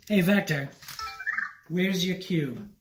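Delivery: ordinary delivery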